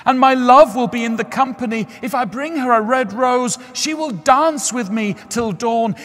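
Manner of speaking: high, loud, yearning youth; a young lover pouring out his heartbreak; lovesick student. A narrator's reading. Student voiced in story.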